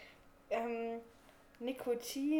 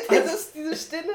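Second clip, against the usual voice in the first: drawn out through laughter; laughing; 5 semitones higher, 15 dB louder, slower than usual. Real, not acted. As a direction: laugh the words out slowly. Laughing at awkward silence.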